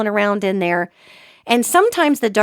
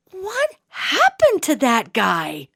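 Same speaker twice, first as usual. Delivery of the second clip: put-on child's horrified question; 4 semitones higher, much slower than usual. Kid's question voiced in story.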